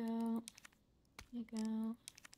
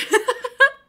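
high nervous laugh; 14 semitones higher, 12 dB louder than usual. Real, not acted. Laugh after the scare.

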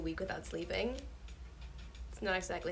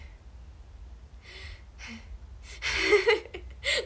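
breathy laugh building to high giggles; 9 semitones higher than usual. Real, not acted. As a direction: break slowly into giggles. Laughs after own rhyme.

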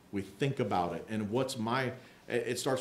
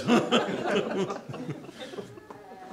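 a real unvoiced laugh; breathy laugh; 6 semitones higher than usual. Real, not acted. Preacher to the congregation, a real breathy laugh. Laughing at his own joke.

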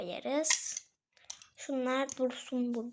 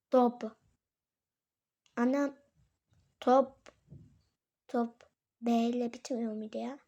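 usual speaking voice

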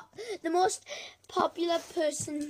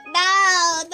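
mock Luigi; 4 semitones higher, 11 dB louder than usual. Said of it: "And Luigi's like".

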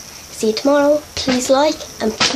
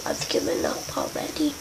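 whispered aside, much lower; 9 semitones lower, 9 dB quieter than usual. Real, not acted. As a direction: breathe it out like a giving-up aside. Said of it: "aside, whispered".

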